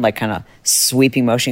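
usual speaking voice

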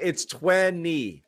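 slow drawn-out Boston twenty; thick Boston accent. Shown as a demonstration. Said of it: "That's a thick Boston accent right there".

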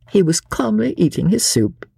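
ordinary delivery

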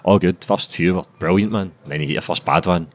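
ordinary talk, nothing marked

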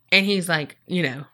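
usual speaking voice

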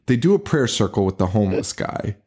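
ordinary talk

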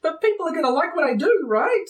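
high, uncertain wizard voice; nervous young wizard. Acted. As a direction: pitch up, seek reassurance.

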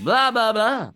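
high, loud mock blahs. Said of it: "She's like".

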